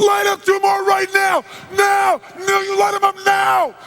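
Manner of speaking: high, very loud frantic order; explosive crazed command; screaming. Retold or imitated. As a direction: scream it like a madman. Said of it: "I'd be screaming".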